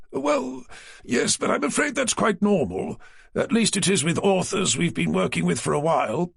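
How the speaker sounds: a prim, slightly pompous office man; publisher Naylor. A narrator's reading. Naylor explaining.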